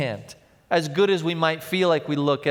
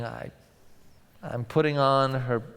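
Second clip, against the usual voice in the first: normal talking voice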